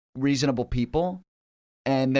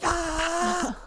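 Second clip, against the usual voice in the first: high, contained scream; comic retold scream of frustration; mock scream; 3 dB quieter than usual. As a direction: let out a high scream at no great volume. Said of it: "you just scream for an hour".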